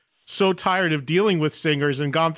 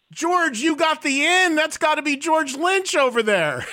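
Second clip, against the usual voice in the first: higher, slow, eager retelling of his own reaction; excited self-quote; 10 semitones higher than usual. Retold or imitated. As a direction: replay your own thrilled outburst, pitched up and drawn out. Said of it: "I'm like".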